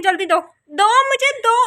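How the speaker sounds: high, loud snatching demand; demanding shout. Real, not acted. Big sister loudly demanding her things back. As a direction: yell at her to hand it over.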